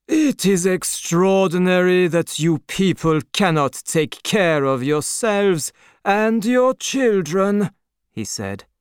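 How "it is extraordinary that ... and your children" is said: contemptuous Marquis. A narrator's reading. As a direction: lecture the peasants from above. Marquis scolds crowd.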